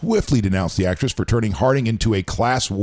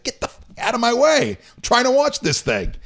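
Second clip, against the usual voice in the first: high-pitched mock irritation, imitating her; annoyed actress brushing off camera; 13 semitones higher than usual. McDormand shooing the camera person away, mock exasperated and high. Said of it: "you see her just go like this".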